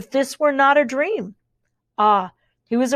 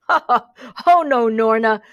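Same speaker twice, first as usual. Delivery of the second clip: a louder laugh, mildly amused; a man scoffing at a silly idea; laughing shout; 4 dB louder than usual. Acted. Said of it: "the man shouted with laughter".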